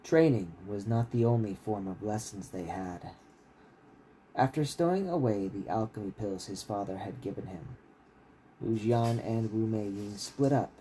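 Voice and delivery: steady, measured reading of a fantasy novel; story narration. A narrator's reading. Author reading his novel to stream listeners, steady narration. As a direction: read the chapter out like an audiobook.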